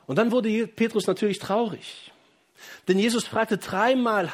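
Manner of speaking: fervent preaching. Acted. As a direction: preach it out to the whole church.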